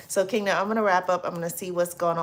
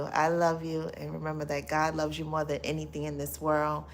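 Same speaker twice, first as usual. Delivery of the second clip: normal talking voice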